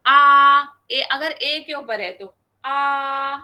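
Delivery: आ held long and level; level first tone. Shown as a demonstration. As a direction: stretch आ flat and steady like four alifs. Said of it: "चार अलिफ के बराबर".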